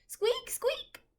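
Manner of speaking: high tiny double squeak; mouse squeaks. Acted. Mouse sound.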